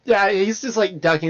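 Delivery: ordinary talk, nothing marked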